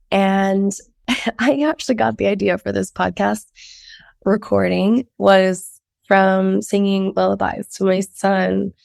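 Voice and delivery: ordinary delivery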